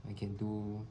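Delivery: ordinary delivery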